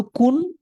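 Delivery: কুন said with a rounded u, rising; দীর্ঘ উ দিয়ে কুন; clear kun sound. Shown as a demonstration. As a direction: stretch কুন clearly for the class. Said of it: "একটা শুধু কান".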